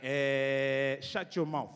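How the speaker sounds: teacher's eh filler. Retold or imitated. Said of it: "Be like".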